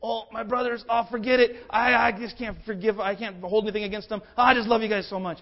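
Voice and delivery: mock-emotional gushing brother. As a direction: overdo the teary brotherly love. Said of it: "He doesn't just say".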